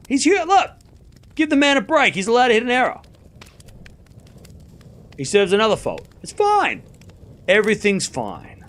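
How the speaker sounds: high, strained mock reassurance; sarcastic high-pitched it's fine; mock pleading defence. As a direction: pretend all is fine while it falls apart. Mock defending Sinner, pitch jumps.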